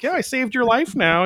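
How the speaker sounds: high cocky little sprite. Retold or imitated. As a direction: brag with a smug grin. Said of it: "Puck's a little cocky here".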